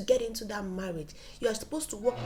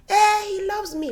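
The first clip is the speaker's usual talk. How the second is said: mom's reply; 11 semitones higher, 13 dB louder than usual. Retold or imitated.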